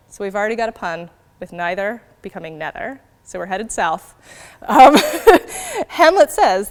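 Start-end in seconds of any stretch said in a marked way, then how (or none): 4.6-6.7 laughing. Real, not acted. Laughing at the fart pun.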